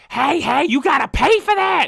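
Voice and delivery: high, full-voiced accusing screech; screaming old lady. Imitating a store worker chasing a customer, screaming. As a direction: shriek it to stop someone leaving. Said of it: "And she's screaming at me".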